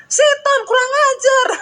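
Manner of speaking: very high, loud squawking shout; shrieking bird yelling insults; parrot shouting curses. Retold or imitated. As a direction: screech it out like an angry parrot. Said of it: "dia bilang".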